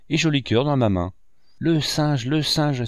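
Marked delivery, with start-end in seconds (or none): 1.6-2.8 put-on mildly excited child's exclamation, higher; a touch of delighted calling out; excited cry. A narrator's reading. Arthur spots the monkey coming aboard, excited cry. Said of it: "s'écria Arthur".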